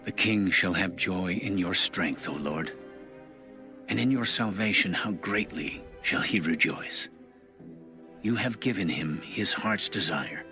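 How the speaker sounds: ordinary delivery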